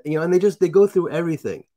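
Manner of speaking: ordinary delivery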